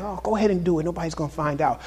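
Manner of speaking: coaxing tempter. Retold or imitated. Preacher voices the devil tempting believers, quietly coaxing. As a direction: imitate a tempter, keeping it quiet and casual.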